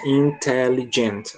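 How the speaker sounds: slow, syllable by syllable: in-tel-li-gent; counting syllables. Shown as a demonstration. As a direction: break "intelligent" into slow separate beats. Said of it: "Se você contar".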